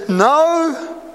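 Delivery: high, drawn-out little "no"; little inner voice. Acted. Conscience answering the believer, in a high little voice. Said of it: "This little voice says".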